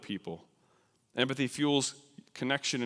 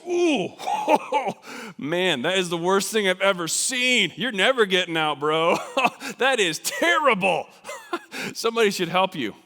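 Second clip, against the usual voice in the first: loud, high, laughing gawker; mocking sympathy; 7 semitones higher, 8 dB louder than usual. Onlooker laughing down the cliff at the stranded person. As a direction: cackle at their trouble from above. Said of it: "Sympathy looks over the edge and says".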